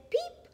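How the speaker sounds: tiny high peep; very high, short baby-bird beep; chick's peep. A narrator's reading. Chick greets the truck, high peep. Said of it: "her chick said".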